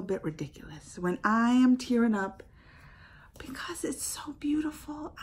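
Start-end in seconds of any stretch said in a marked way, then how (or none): 3.4-5.1 soft breathless whisper of awe; emotional whisper. Real, not acted. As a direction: say it hushed, almost to yourself. Emotional aside while tearing up.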